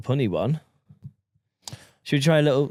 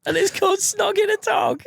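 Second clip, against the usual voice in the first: high-pitched insistence. Real, not acted.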